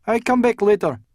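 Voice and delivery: put-on high, loud Eastern European accent; thick Eastern European accent. A narrator's reading. Startled office cleaner to detective, thick Eastern European accent. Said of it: "said in a thick accent from Eastern Europe".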